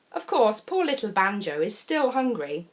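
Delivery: ordinary delivery, nothing marked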